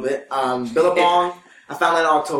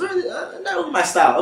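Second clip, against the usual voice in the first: customer lady. Retold or imitated. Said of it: "And she goes".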